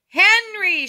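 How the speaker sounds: high, loud name call; shouting. A narrator's reading. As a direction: shout for your son. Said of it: "shouted Mister Huggins".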